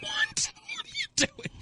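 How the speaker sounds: a high, breathless whisper of disbelief; shocked whisper. Retold or imitated. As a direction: gasp the words out in a stunned whisper. Shocked reply to wife's plan.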